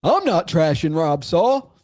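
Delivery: sneering, laughing, slow mock voice; a hammed-up mock of a smug rival; mocking impression. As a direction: parody his smug denial with a laugh. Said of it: "Why he laughed".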